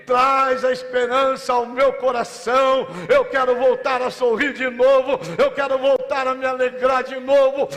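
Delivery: loud, high, fervent sermon cry; rousing pulpit proclamation; full-force preaching. Acted. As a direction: preach it out to the whole church. Preacher exhorting the church.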